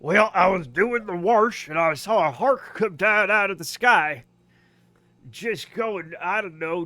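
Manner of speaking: lightly voiced Missouri neighbor; folksy Missouri yarn, lightly put on; Missouri accent. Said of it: "that's how they talk in Missouri".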